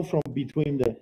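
ordinary talk, nothing marked

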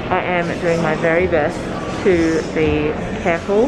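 muffled behind a face mask; speaking through a mask. Real, not acted. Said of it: "Excuse the mask".

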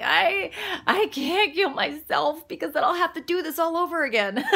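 high, loud, half-laughing exclamation; laughing despair. Retold or imitated. Recalling suicidal thoughts to viewers, laughing. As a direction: throw it out laughing at yourself. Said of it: "And I was like".

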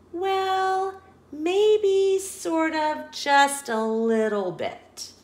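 high, slow guilty hedging; child owning up to her dad, slow and sheepish; sheepish admission. Acted. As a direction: squirm your way through the confession. Penelope admits eating classmates.

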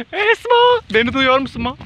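a playful high call to a friend far away. Real, not acted. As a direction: shout across the hills to a friend.

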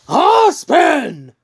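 high, loud acted scream; explosive high-pitched roar; angry yell. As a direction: yell the name in boiling rage. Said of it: "the anger is bu-, building up".

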